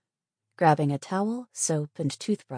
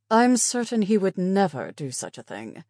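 higher, louder, affronted nurse; displeased; 5 semitones higher, 6 dB louder than usual. Acted. Displeased nurse answering the patient's remark about the guard, affronted. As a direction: defend him with displeasure. Stared at her with distinct displeasure.

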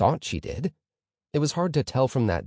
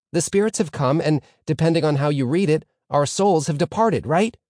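eager pupil answering, higher and louder; keen student Reuven; 6 semitones higher, 4 dB louder than usual. A narrator's reading. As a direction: answer keenly as the star pupil.

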